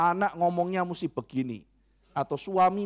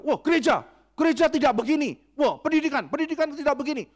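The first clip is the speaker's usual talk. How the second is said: high, loud disapproving rant; indignant scolding; 9 semitones higher, 3 dB louder than usual. Acted. The control freak scolding church and schools, indignant and high.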